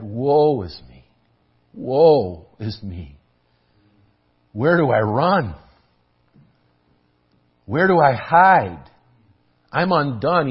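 slow grief swelling into a loud cry; anguished lament. Acted. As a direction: cry out Isaiah's despair from the pulpit. Isaiah's lament acted out.

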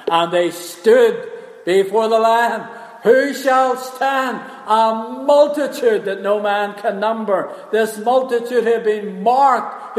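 loud, high, slow preaching at full force; impassioned preaching. Acted. Preacher answering who shall be able to stand, impassioned. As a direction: ring out each key word to the congregation.

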